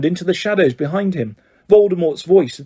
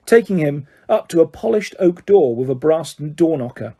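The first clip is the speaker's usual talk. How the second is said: ordinary delivery, nothing marked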